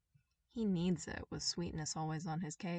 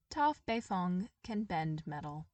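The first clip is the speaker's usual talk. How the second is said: ordinary delivery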